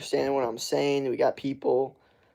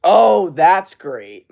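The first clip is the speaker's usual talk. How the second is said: loud, drawn-out realization; realizing; 4 semitones higher, 11 dB louder, much slower than usual. Real, not acted. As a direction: stretch out the sudden realization. Rereading a chat message.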